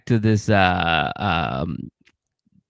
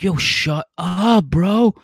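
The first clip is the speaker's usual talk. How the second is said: higher, slow urgent hush at his buddy; hissed hushing friend; 8 semitones higher, much slower than usual. Retold or imitated. Gabe hushing his friend at dinner, urgent and high. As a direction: shut him up fast, under his breath. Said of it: "And Gabe's like".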